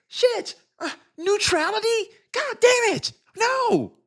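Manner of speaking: very high squeaky voice; mock a dim critic in a shrill squeal; high, squeaky parody of a flustered critic. Mocking critics as squealing simpletons.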